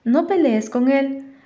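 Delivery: pleading. A narrator's reading. Faith begs Walter not to fight Dan, pleading. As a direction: plead with him to back off. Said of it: "rogó Faith".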